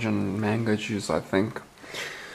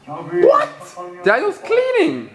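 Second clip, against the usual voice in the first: mock amazed; slower than usual. Real, not acted. Amazed friend is cleaning.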